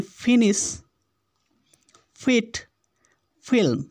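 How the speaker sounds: फिनिश, फिट, फिल्म with a short "i". Shown as a demonstration. Teacher shows the matching vowels, short i in फिनिश, फिट, फिल्म. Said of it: "छोटी ई की मात्रा का उतार".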